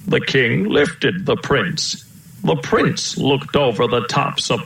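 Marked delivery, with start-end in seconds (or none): none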